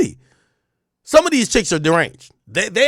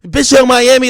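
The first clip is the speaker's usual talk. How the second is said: put-on nagging girlfriend, higher and louder; chirping girlfriend; 7 semitones higher, 7 dB louder than usual. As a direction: complain like a jealous girlfriend. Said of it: "when a girl start chirping".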